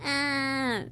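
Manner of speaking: mock baby wail, then drop the act; imitated Baby Nut. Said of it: "he goes".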